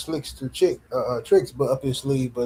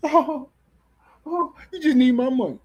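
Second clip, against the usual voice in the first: very high-pitched mocking gasp of understanding; ridiculous mock realization; 9 semitones higher than usual. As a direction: taunt in a silly high voice. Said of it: "This is how ridiculous A J fans sound".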